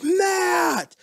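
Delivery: high, drawn-out shout of a name; imitated excited call. Fan hailing the host at a conference booth, excited call. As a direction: call out as if spotting a friend.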